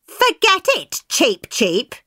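high, cheeky little voice; cheeky pancake. Acted. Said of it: "the pancake said".

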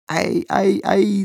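put-on high cooing to a baby; high-pitched baby cooing; baby talk. A narrator's reading. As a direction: coo at the crying infant. Father coos at baby.

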